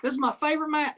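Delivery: very high put-on winner's voice; high-pitched, playful acceptance speech; Nigel, mock victory speech. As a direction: imitate a champion thanking the folks back home. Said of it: "Nigel wins the fucking match".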